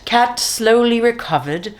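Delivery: usual speaking voice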